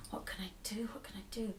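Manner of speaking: anxious whispered muttering to himself; worried whisper. Acted. Enki pacing alone over Inanna, worried whisper. Enki paces, thinking aloud.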